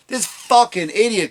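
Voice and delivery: ordinary talk, nothing marked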